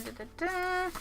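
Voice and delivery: dun dun dun sung up high and soft; soft high suspense hum. Pretend. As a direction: lift the sting up like a cliffhanger. Sting answered higher.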